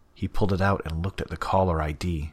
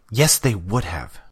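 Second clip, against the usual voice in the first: interrupting; 4 semitones higher, 6 dB louder, slower than usual. Acted. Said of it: "she interrupted".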